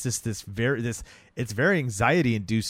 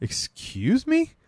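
much higher, drawn-out slow excuse me; mock-offended girlfriend, stretch it out; indignant disbelief; much slower than usual. Girlfriend hearing her mom hypnotized him, mock-outraged high drawl.

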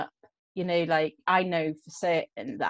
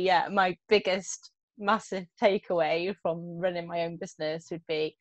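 ordinary talk

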